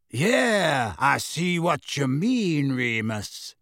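slow, calm, deep-voiced wizard; unhurried and steady. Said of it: "a deep, slow voice".

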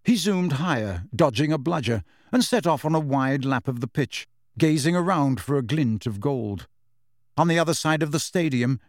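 ordinary delivery, nothing marked

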